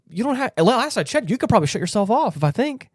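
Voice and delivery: as if arguing with the AI directly; exasperated. Real, not acted. Exasperated rant at the AI.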